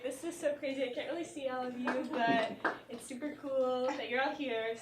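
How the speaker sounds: ordinary talk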